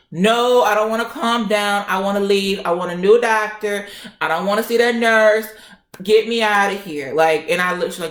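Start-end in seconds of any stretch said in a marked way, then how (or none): 0.1-7.1 worked-up retold refusal, raised voice; pissed off. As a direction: refuse loudly, demand to get out. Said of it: "I'm pissed".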